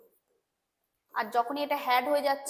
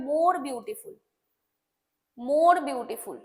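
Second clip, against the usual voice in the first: more beautiful said slowly, leaning on more; slow emphatic demo; much slower than usual. Shown as a demonstration. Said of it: "তখন আমাকে করতে হবে". (ধীরে, more শব্দে জোর দিয়ে)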